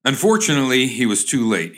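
ordinary talk

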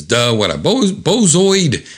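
duh, rising high on the insult; comic-book taunt; mocking jeer. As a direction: jeer at a fool, pitch climbing. Kevin mocks the fooled foe.

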